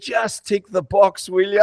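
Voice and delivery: impatient demand. Retold or imitated. Retelling to the host how he told his doctor off, slow loud impatience. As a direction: drag each word out like he's fed up.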